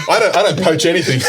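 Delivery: high, loud, laughing denial; laughing protest. Real, not acted. Teased player denies poaching from partner, laughing protest. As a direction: protest through real laughter.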